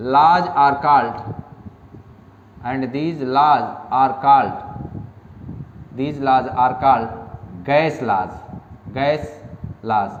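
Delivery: slow emphatic dictation. Shown as a demonstration. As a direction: dictate firmly and slowly. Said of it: "लिख लीजिए".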